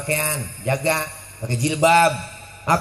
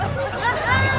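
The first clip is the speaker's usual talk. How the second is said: much higher, slow squeaky voice; comic squeak. Real, not acted. Preacher jokes in the dark, high slow squeak. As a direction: squeak it out slowly like a cartoon. Lights go out, joke.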